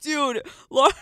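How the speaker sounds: loud laughing call to a friend; shout. Real, not acted. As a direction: yell at her in disbelief. Calling out to friend.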